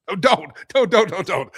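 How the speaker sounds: high, loud pleading don't; laughing protest. Real, not acted. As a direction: beg him to stop, half laughing. Protesting Jeff's report.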